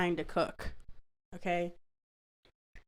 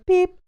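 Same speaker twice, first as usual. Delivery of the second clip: high, loud, short imitated beep; imitated fan beep; 11 semitones higher, 8 dB louder than usual. Describing the fan button, a high loud mock beep. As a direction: mimic the fan's beep, much higher and louder. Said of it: "it makes a little beep sound".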